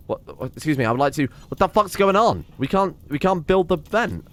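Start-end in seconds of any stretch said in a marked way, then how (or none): none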